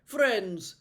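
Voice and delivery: loud noble call; rally them with authority; commanding prince. Acted. Prince rousing the party, loud commanding voice.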